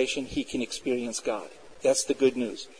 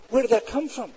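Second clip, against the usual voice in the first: ordinary talk, nothing marked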